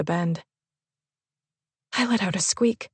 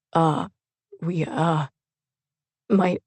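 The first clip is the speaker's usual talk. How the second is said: stammering; 2 semitones lower, much slower than usual. A narrator's reading. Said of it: "Charlie stammered".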